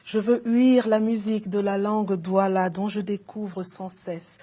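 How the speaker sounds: measured, lyrical poetry reading; poem recitation. Acted. Poet reading her longing for home to an audience, lyrical recitation. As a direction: recite each image with deliberate phrasing.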